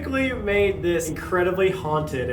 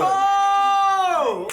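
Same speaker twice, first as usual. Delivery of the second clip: high, loud cheer; excited shout; 5 dB louder than usual. Real, not acted. Reacting to spirit box.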